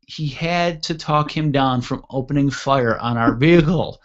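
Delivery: ordinary delivery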